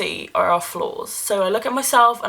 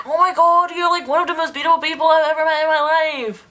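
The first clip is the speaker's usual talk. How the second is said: gushing to a friend; 7 semitones higher than usual. Retold or imitated. Retelling telling her high school friend she's beautiful, higher excited gush. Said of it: "And I was just like".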